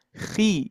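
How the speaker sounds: 「Khi」は厚みを少し減らして; lighter khi. Shown as a demonstration. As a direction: say Khi with the thickness eased off a little. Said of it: "kurangi ketebalannya".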